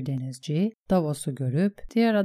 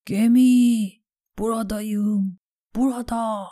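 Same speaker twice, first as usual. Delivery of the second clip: raised, drawn-out cries for attention; shouting; 6 semitones higher, 5 dB louder than usual. A narrator's reading. Stranded Davos calls to the passing ship, shouting. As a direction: call across the water to be noticed. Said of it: "diye bağırdı rüzgara".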